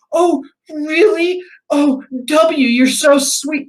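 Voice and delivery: high, drawn-out girlfriend imitation; gushing girlfriend. Girlfriend touched by a surprise proposal, gushing.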